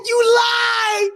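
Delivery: high-pitched kid wail, acted; hammed-up tearful accusation; mock screaming. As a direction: wail it high, half laughing. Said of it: "I'm just screaming, yelling".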